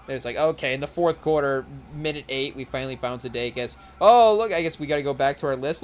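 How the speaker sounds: ordinary talk, nothing marked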